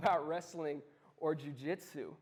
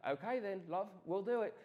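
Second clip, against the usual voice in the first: put-on light South African accent; easygoing reply in a lightly South African voice; a hint of a South African accent; South African friend; 4 dB quieter than usual. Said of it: "he grew up in South Africa".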